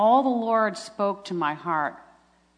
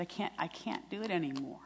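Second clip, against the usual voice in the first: retold despair; 10 dB quieter than usual. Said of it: "when I was so low".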